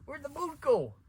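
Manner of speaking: high, loud imitated yell; the father shouting at his son about the boot; dad alarmed. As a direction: shriek it like a panicked father. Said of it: "He's like".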